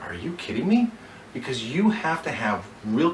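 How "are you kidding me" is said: higher, disbelieving question; incredulous. Shown as a demonstration. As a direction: react as if the offer shocks you. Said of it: "with as much genuine concern, like".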